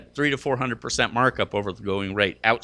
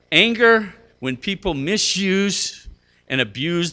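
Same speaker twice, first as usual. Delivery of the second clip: emphatic, slow; 7 semitones higher, 4 dB louder, slower than usual. Real, not acted. Preacher builds the point.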